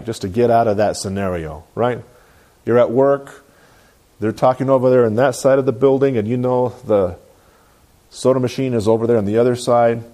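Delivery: ordinary delivery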